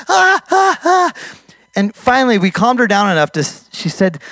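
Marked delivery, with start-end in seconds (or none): 0.1-1.1 high, repeated imitated screams; retold panicked screams. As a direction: cry out in bursts like a terrified woman. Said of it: "and she's screaming".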